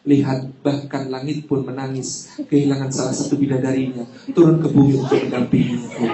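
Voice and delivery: low, soft romantic voice; romantic whisper-soft line. Retold or imitated. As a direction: woo her gently and low. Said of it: "saya katakan kepadanya". (suami merayu istri dengan suara rendah dan lembut)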